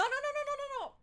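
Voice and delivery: rapid high-pitched panicky squeal; panicked no's. Real, not acted. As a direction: blurt a string of high, alarmed no's. Panics at a game mishap.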